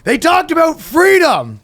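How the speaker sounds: high, loud hammed-up yelling; exasperated parent. Parent pulling child from Cubs, hammed-up exasperation. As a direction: scold the kid in mock hysterics.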